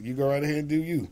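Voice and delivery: ordinary delivery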